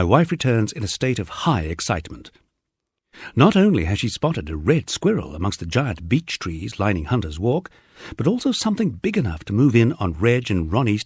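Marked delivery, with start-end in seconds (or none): none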